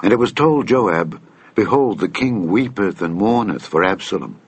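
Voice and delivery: ordinary delivery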